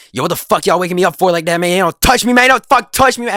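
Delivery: high, loud, rapid mock tirade, building to a yell; imitated angry outburst. Woken friend tells classmates not to touch him, escalating angry yell. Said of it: "working himself up in a way".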